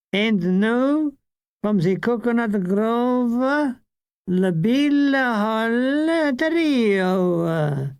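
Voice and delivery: hammed-up French compere, slow announcement; awful French accent. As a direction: announce the trio in a bad French accent, drawn out. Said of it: "an awful French accent".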